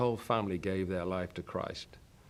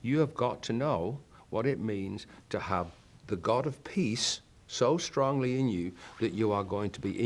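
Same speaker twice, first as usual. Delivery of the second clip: ordinary talk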